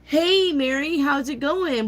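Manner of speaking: high, put-on friendly chatter voice; cheery chatty greeter. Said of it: "somebody's like".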